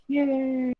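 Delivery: high quick cheer; cheery yay. Acted. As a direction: cheer brightly.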